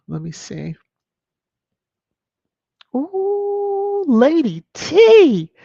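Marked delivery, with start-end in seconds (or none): 3.0-5.5 slow, high, loud drawn-out teasing; teasing call. Real, not acted. Host teasing viewer Lady T about her comment, drawn out. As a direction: stretch her name out to tease her.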